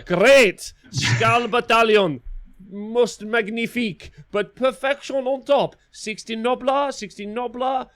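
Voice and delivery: put-on high, loud, hammy French accent; French chef. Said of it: "That's my French".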